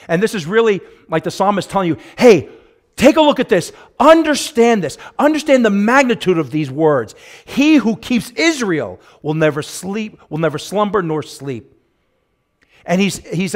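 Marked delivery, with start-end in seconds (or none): 2.2-11.7 loud, high, rising pulpit cadence; preaching at full force. Acted. Pastor proclaiming God's promise to the church, loud full-force preaching. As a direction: build it up like a revival preacher.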